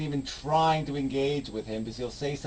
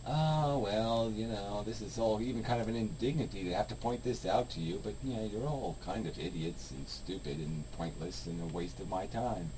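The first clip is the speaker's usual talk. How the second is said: very soft, low, unbothered impression; calm weary disdain; 5 semitones lower, 9 dB quieter than usual. Imitating the YouTuber's calm putdown of idiots, calm and weary. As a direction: quietly imitate someone too weary to argue. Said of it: "You just seemed kind of".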